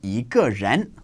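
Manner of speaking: yi in Yi ge ren rising, second tone. Shown as a demonstration. Teacher demonstrating to learners, yi rising. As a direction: lift yi upward in Yi ge ren. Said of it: "change to a second tone if it precedes a fourth tone".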